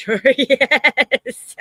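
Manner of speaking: slow, high, loud laughing yes. Real, not acted. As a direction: laugh right through it. Laughing at Rev's guess.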